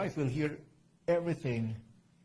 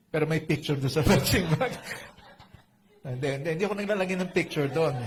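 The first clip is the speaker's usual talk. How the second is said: loud laughing denial; louder, laughing it off; real laughing protest to the crowd; 7 dB louder, faster than usual. Real, not acted. Joking about photo on punching bag.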